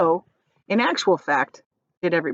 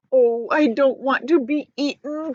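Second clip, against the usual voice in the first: higher fearful whine; crying in fear; 6 semitones higher than usual. Acted. Earthworm afraid of being eaten, fearful whine. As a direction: whimper it out. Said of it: "cried the earthworm".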